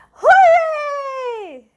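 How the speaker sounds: high excited cheer; joyful shout. Acted. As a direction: throw up a happy hooray for the kids. Celebrating being a bird.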